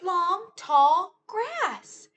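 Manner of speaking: drawn-out storytime announcement; dramatic discovery. Acted. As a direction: stretch it out like spotting something big. Discovering an obstacle.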